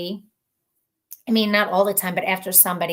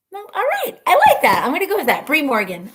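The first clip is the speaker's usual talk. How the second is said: much higher and louder delight; thrilled decision; excited high exclamation; 7 semitones higher, 6 dB louder, faster than usual. Real, not acted.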